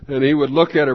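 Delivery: ordinary talk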